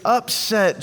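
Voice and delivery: normal talking voice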